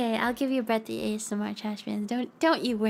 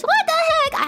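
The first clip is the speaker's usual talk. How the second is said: much higher, louder outraged squeak; mock indignation; 7 dB louder, slower than usual. Streamer answering a fan who called her dingus, mock outrage. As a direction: play offended at the fan.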